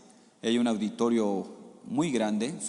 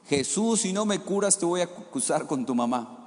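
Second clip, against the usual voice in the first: put-on higher, louder childish cry; the boy yells it again, more insistent; child shouting louder; 6 semitones higher than usual. Boy yelling over his mother's hushing, high and louder. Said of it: "él gritaba más fuerte".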